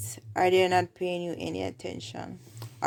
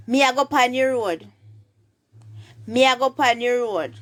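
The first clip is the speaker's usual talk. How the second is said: higher, louder Jamaican Patois demo; 4 semitones higher, 5 dB louder than usual. Shown as a demonstration. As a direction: project the Patois line for learners. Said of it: "how would you say".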